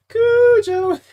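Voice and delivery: Cujo squealed high and laughing; mocking his own mistake; squeaky mock voice. Mocks his wrong version.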